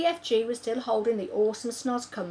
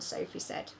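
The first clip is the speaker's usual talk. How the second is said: usual speaking voice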